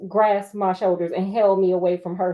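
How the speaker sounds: usual speaking voice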